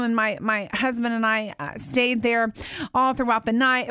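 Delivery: usual speaking voice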